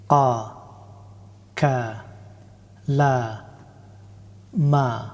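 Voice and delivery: each letter with fatha said alone, with long pauses to repeat; teacher drilling Arabic letters with fatha, one at a time; drill syllables. Shown as a demonstration. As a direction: clear slow syllable drill for learners to repeat. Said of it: "উচ্চারণটা practice করব".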